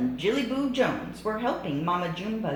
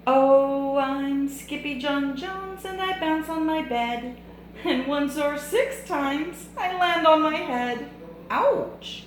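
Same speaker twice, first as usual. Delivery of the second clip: put-on high, giddy Spanish-accented kitten; bouncy kitten Skippy; 10 semitones higher, 5 dB louder than usual. Kitten sings to himself while bouncing on his bed, high and giddy. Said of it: "use a Spanish accent like Skippy John Jones".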